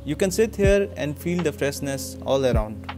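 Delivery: ordinary delivery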